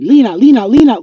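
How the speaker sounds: loud repeated calls for her; calling out her name. Retold or imitated. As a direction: call her name over and over like everyone needs her. People constantly calling her name.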